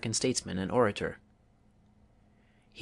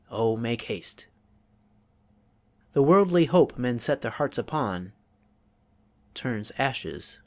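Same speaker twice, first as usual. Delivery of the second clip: put-on solemn recital of old verse; dramatic verse recitation. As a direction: let each pause hang like a sigh. Recites Rubaiyat verses as example.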